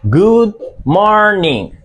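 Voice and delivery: slow, careful "Good morning"; model pronunciation. Shown as a demonstration. Teacher drills "Good morning" slowly for the class. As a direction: stretch out "Good morning" for learners. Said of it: "Repeat after me".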